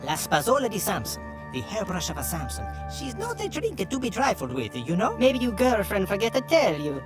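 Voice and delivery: lightly hammed Italian accent telling a legend; Italian barber accent. Barbers reveal the hairbrush secret to the detective, stage Italian.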